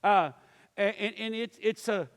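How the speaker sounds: ordinary delivery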